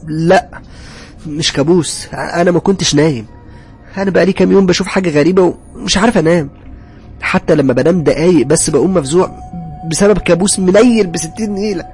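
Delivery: exasperated. A narrator's reading. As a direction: protest in distress, pitch pushed up. Distressed husband answering worried wife. (منفعل ومتضايق بصوت عالي)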